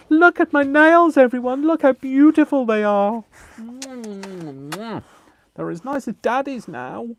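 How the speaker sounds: high, loud, proud voice for the dog; voicing the dog. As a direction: speak as the dog showing off his nails. Voicing the dog showing nails.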